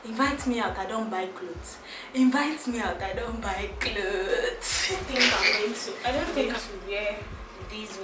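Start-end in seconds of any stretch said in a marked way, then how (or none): none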